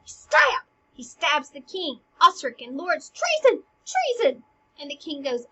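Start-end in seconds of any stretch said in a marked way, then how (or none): 0.1-0.6 high, loud sudden burst; stab!. Acted. Teacher acting out the final blow, sharp excited burst. Sound effect of stabbing. 3.1-4.4 high loud alarmed shouts; crying treason. Acted. Lords crying out as the king is stabbed, high alarmed shouts.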